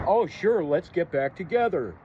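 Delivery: sarcastic jab at his ex; sarcastic mockery. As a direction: scoff her offer back at her. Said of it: "love her too much".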